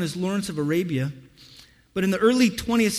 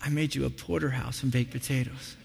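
soft wife voice; 4 semitones lower, 7 dB quieter than usual. Retold or imitated. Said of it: "she goes".

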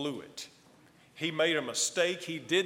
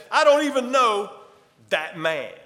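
emphatic, higher and louder denial; 8 semitones higher, 10 dB louder than usual. Acted. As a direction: shout the denial as Peter. Peter denying Jesus by fire.

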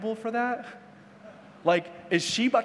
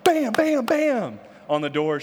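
high, loud hammering bams; imitated door banging; 6 semitones higher, 4 dB louder, slower than usual. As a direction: bang it out like a hammer.

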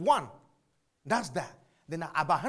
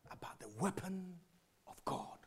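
whispered; 13 dB quieter than usual. Acted. Preacher to congregation, sudden dramatic whisper. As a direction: drop to a whisper.

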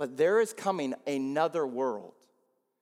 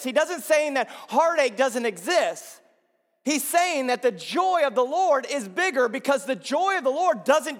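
preaching at full force; 11 semitones higher, 5 dB louder than usual. Acted. Pastor urging the church to look beyond, preaching at full force. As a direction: lift the congregation with each phrase.

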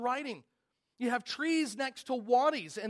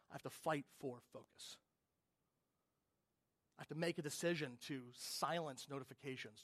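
ordinary delivery

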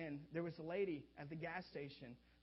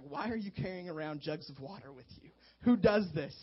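incredulous lady; 11 dB louder than usual. Retold or imitated. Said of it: "the lady's like".

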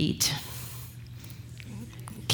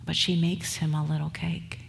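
ordinary talk

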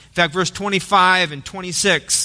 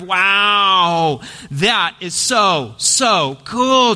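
starstruck wow; much slower than usual. Pretend. Preacher imitating disciples gushing over Jesus, excited. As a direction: gasp it out like a fan.

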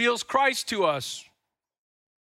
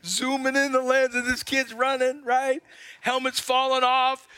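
normal talking voice

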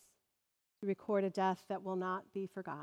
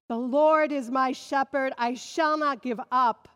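proclaimed; 5 semitones higher, 9 dB louder than usual. Acted. Repeated psalm refrain, loud.

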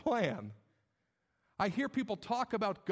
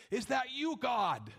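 questioning God as Abraham; 4 semitones higher, slower than usual. Acted. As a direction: call up to God, pitched high then dropping. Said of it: "In the same way Abraham said it".

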